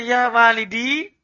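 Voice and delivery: "ya walidi" drawn up into a rise at the end; questioning tune. Shown as a demonstration. Teacher showing the student's mistake, "ya walidi" as a question. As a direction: show the wrong questioning lift on "ya walidi". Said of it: "Itu bertanya".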